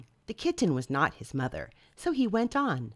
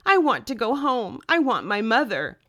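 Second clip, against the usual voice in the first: upset; 6 semitones higher, 8 dB louder than usual. Acted. Lost bird wants home.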